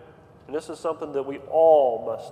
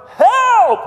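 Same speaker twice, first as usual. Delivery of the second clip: a high, loud yelled Help!; drowning man screaming to the Lord for help, high and loud; crying for help; 15 dB louder than usual. Retold or imitated. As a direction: shout Help! like someone drowning. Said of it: "You're crying".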